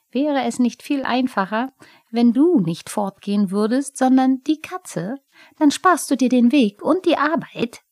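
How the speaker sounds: a huffy little goblin scoffing at the cat; Pumuckl the kobold. Acted. Pumuckl schlägt listig vor.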